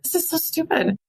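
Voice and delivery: high, tearful complaint; mock crying. Wife retelling her cries while caring for him, mock crying. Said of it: "I would just cry".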